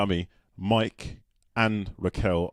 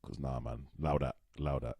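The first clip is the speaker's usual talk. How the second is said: low, soft South London street slang; South London accent; 5 semitones lower, 9 dB quieter than usual. Shown as a demonstration. Rejecting bruh to a co-host, quiet South London accent. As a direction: lay it back, London style. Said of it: "from South London".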